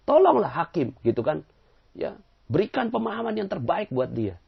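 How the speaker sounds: high, loud indignant whine to the judge; teacher whining to Judge Bao about the man; whiny complaint. Acted.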